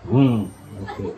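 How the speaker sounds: low, brief approving hum; hummed motherly approval; acted approving mother. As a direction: play a mother giving a low-pitched okay. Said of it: "Mama bilang".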